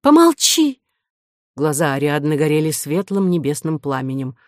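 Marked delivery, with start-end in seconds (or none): 0.0-0.8 high, loud, curt order for silence; imperious heroine's outburst; angry command. A narrator's reading. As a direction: shut him up with a raised voice. Heroine angrily silences her interrupting lover.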